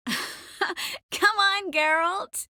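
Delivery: laugh, then a high, lilting call; laughing urging. Acted. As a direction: urge him on through laughter. Laughing while urging Geralt on.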